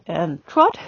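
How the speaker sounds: TROT rising at the end; upward transition command. Shown as a demonstration. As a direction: lift the voice on "trot". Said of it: "the voice goes up".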